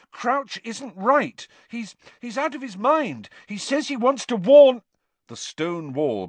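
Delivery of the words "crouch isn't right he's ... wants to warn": high, agitated pleading; heated and urgent, building until cut off; angry insistence. A narrator's reading. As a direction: argue angrily at a raised pitch. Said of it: "said Harry angrily".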